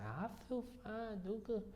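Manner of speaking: high, slow grandma voice reassuring; imitating grandmother. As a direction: drawl it high like an old lady. Voicing grandmother Cookie.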